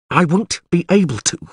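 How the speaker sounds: high, tight-jawed retort; through the teeth. A narrator's reading. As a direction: throw it back through gritted teeth. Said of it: "she said through her teeth".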